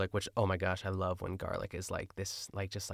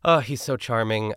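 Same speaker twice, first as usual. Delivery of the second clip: ordinary talk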